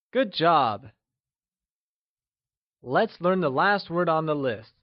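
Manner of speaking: normal talking voice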